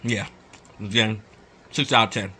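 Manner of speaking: talking with mouth partly full; food-muffled speech between bites; chewing food. Real, not acted. Seen 3: Bites at -7/-5, food visible in mouth at +0.1 and +0.6.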